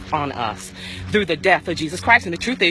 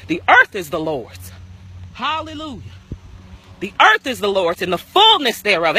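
loud, high, fervent preaching voice; 3 semitones higher, 3 dB louder than usual. Acted. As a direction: preach it with full force, rising. Preaching at full force.

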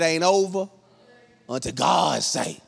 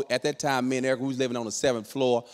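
ordinary delivery

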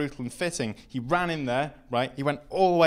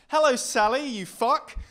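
loud, higher jeering greeting; mock taunt; 10 semitones higher, 5 dB louder than usual. Comedian taunting his girlfriend in the audience, loud jeer. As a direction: sneer at her from the stage.

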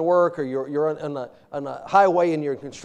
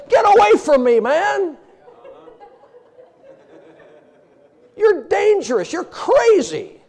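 high, loud panicked yelling; alarmed; 12 semitones higher, 4 dB louder than usual. Retold or imitated. Patient yelling at the reckless eye doctor in mock panic. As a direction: back away yelling in mock fright. Fleeing the man with the beam.

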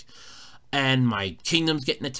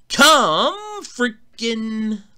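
exasperated; 10 semitones higher, 5 dB louder, much slower than usual. Real, not acted. Exasperated outburst.

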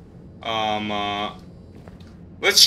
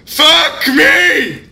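startled scream; 9 semitones higher, 4 dB louder than usual. Real, not acted. Jump scare in horror game.

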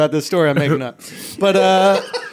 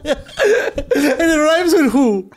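laughing; 8 semitones higher, much slower than usual. Real, not acted. Joking while laughing.

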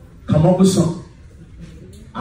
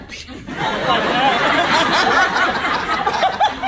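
high breathy giggle at own joke; real giggle at his own punchline; 7 semitones higher, 3 dB quieter than usual. Real, not acted. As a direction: let a giggle spill out.

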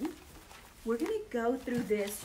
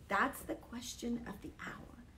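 whispered, hushed question; sermon hook whispered to listeners; dramatic whisper; 7 dB quieter than usual. Acted. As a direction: drop to a whisper for effect. Preacher drops to whisper.

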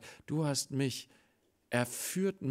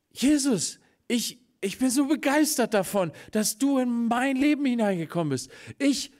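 mild excitement; 9 semitones higher, 6 dB louder than usual. Acted. Zachäus overjoyed speaking to Jesus.

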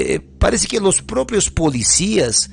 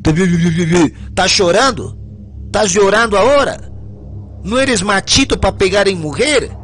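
mocking taunt; 4 semitones higher than usual. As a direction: ridicule him as a coward. Prisoner mocks crying man.

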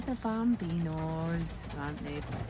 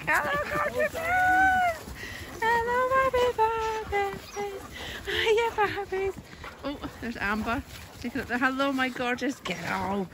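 very high, loud, slow doggy talk; 13 semitones higher, 7 dB louder than usual. Real, not acted. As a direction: gush over the dogs like babies. Greeting neighbour's dogs.

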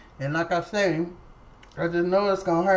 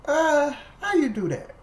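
higher, louder how you do that; amazed; 10 semitones higher, 4 dB louder than usual. Retold or imitated. Patient amazed, asking the nurse how she did it, higher and louder. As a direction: marvel at the nurse. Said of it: "I was like".

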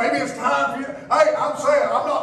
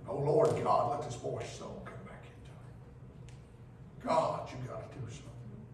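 breathy whispered plea; Elisha's hushed desperate prayer; 5 semitones lower, 11 dB quieter than usual. Acted. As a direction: beg God in a near whisper.

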